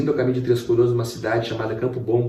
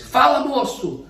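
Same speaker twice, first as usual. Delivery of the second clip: calling out; slower than usual. Retold or imitated. Pastor calls from the window.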